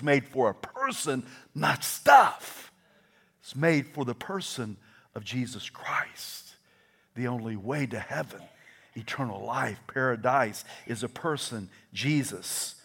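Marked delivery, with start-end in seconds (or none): none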